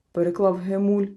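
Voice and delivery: plain reading voice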